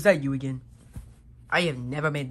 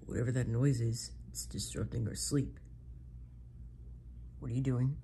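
ordinary talk

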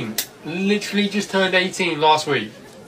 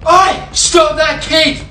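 shout; 12 semitones higher, 6 dB louder, much slower than usual. Acted. Staff chasing the kid.

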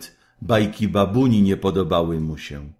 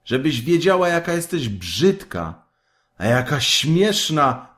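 mocking; 7 semitones higher than usual. A narrator's reading. Kaj mocks Gerda.

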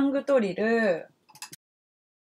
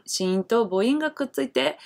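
ordinary talk, nothing marked

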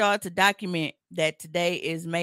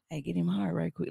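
quiet, low, bossy mutter; low mumbled demand; 8 dB quieter than usual. Retold or imitated. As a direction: mumble it like a lazy order.

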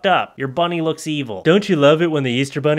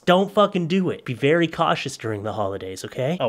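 ordinary talk

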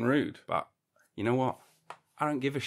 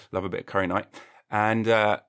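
usual speaking voice

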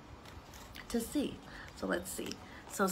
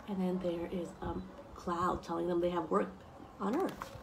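ordinary delivery

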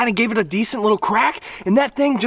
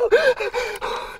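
giddy laugh. Real, not acted. After catching the fish.

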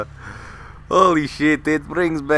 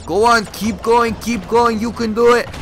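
raised, louder egging-on voice; insistent retold encouragement; urging on; 5 semitones higher, 5 dB louder than usual. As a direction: imitate a friend cheering someone on. Friend urging him to keep inhaling.

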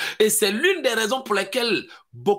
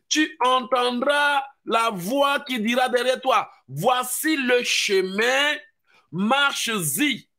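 slower, drawn-out scripture reading; slow proclaiming; 6 semitones higher, slower than usual. Acted. Preacher repeats the verse slowly to the congregation, drawn out. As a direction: savour each word of the verse. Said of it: "C'est ce que la Bible dit".

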